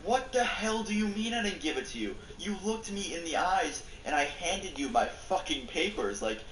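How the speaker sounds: high, furious disbelief; angry outburst. Retold or imitated. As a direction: snap at the teacher, voice up high. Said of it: "that's when I snapped".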